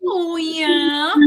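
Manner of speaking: high, loud call-back; answering cheer. Retold or imitated. Crew answering her prank cue, high rousing cheer.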